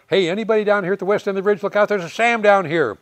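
high, loud warning call over the radio; radio shout. Retold or imitated. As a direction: yell the warning fast, like on a live radio net.

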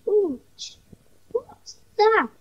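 put-on a child's high startled question; high-pitched ooh of surprise; surprised. A narrator's reading. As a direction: sound suddenly startled by a noise. Startled by a train whistle.

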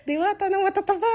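high laughing punchline; giggling self-mockery. Real, not acted. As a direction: laugh through the joke at yourself. Joking about herself as fairy.

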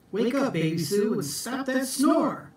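high, quick wake-up call. Acted. Jack waking Baby Sue, high, quick call.

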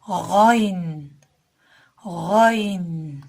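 "Gayn" with a throaty gh; Arabic letter ghayn. Shown as a demonstration. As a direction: gargle the start of "gayn". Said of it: "boğazın evvelinden çıkar". (boğazdan kalın "gayn")

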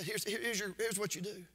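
quiet aside. Acted. Jesus quietly answers rich ruler.

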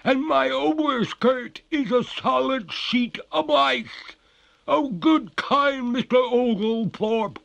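high, slow, loud ghostly pleading; despairing. A narrator's reading. Ghost begs Oglethorpe for a fire, despairing. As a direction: wail and beg for release. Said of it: "cried the ghost in despair".

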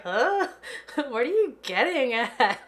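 lightly voiced puzzled Fallon; confused Sim voice. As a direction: play a baffled character, laughing. Voicing Fallon's confused look.

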